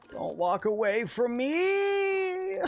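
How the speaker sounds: high, slow whiny cry; mock desperate plea. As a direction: wail after it dramatically. Yelling at fleeing creature.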